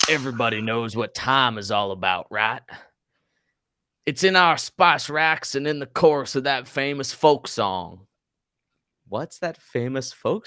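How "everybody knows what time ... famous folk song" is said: put-on cowboy accent. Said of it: "read ... in my Colorado, uh, accent".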